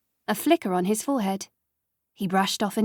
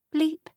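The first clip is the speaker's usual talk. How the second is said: high clipped bleep; high electronic beep; machine bleep; 7 semitones higher than usual. A narrator's reading. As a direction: mimic a hospital monitor. Machine noise imitated.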